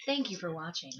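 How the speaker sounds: slow, lightly put-on cabin announcement; flight attendant announcement. Joking host doing a lightly voiced flight attendant announcement. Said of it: "like one of those flight attendant women".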